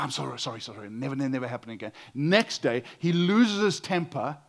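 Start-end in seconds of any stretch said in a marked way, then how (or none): 0.0-1.9 sheepish apology. Retold or imitated. Employee apologising to boss.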